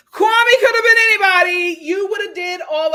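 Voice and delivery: high, loud laughing words; laughing hard. Real, not acted. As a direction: laugh it out, cracking up. Laughing at Chelsea crying.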